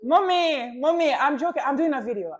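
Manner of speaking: high, loud, desperate interruption; urgent confession. Real, not acted. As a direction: call out over someone's crying to reassure them. Confessing prank to crying mother.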